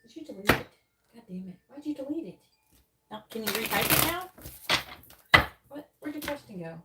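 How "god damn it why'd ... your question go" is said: ordinary talk, nothing marked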